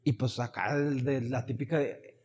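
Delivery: normal talking voice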